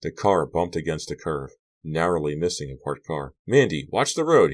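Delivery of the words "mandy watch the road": higher and louder alarmed cry; cried out. Acted. Carrie crying out to Mandy as the car nearly hits another, loud and alarmed. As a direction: shout a sudden warning at the driver. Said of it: "he cried".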